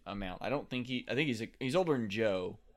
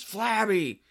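high, loud yell, building on the repeat; imitated screaming; 10 semitones higher, 11 dB louder, much slower than usual. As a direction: mimic screaming at the top of your lungs. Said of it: "he just starts screaming at the top of his lungs".

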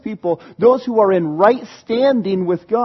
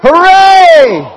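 loud, high cheer; full-throated hooray; shout hooray; 10 semitones higher, 11 dB louder than usual. Real, not acted. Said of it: "We're gonna shout hooray".

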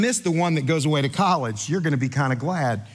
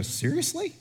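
incredulous laugh; 12 semitones higher, 7 dB quieter than usual. Retold or imitated. Pastor's incredulous reaction.

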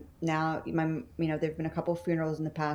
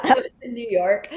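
laughing; 6 semitones higher, 6 dB louder than usual. Real, not acted. Still laughing.

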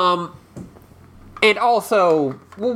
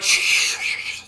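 mock shushing. Everyone hushing the question.

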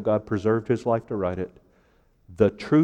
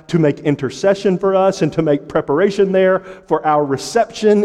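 raised, driving sermon cadence; higher-pitched pulpit proclamation; impassioned preaching; 4 semitones higher, 4 dB louder than usual. Real, not acted. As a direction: preach it in a rolling list of clauses. Preacher recites gospel at full force.

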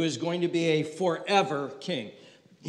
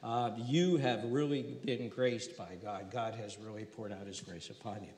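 softer, lower, calming apology; composed, reassuring; 5 semitones lower, 6 dB quieter than usual. Acted. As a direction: angel calming a frightened Mary, soft and low. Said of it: "he kind of gets his composure".

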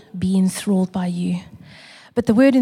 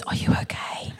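put-on whispered girl; 9 dB quieter than usual. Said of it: "The poor girl next to me goes".

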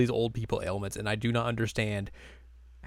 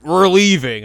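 mock brash announcement; 8 semitones higher, 14 dB louder, slower than usual. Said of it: "Inuyasha is like".

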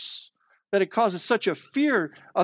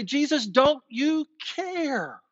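panicked plea; 9 semitones higher, slower than usual. Acted. Panicked disciples wake Jesus.

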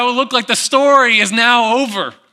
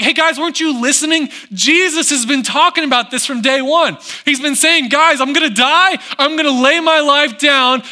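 exasperated angels; 6 semitones higher, 3 dB louder than usual. Acted. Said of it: "They're like".

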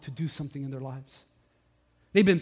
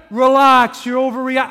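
loud, raised-pitch retort; yelling back; 6 semitones higher, 10 dB louder than usual. Retold or imitated. Line-cutter to the angry pastor, yelling back. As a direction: imitate a man shouting you down. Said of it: "the man started yelling at me".

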